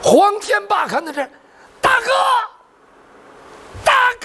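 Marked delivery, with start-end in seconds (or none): 1.8-4.2 high, anguished cry to a dying brother; desperate calling out. Acted. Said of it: "千呼万唤". (撕心裂肺地高声呼唤)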